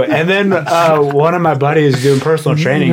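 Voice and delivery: normal talking voice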